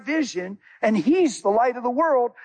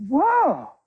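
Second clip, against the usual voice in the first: amazed whoa; 6 semitones higher than usual. Retold or imitated. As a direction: react like she's stunned. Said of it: "She said".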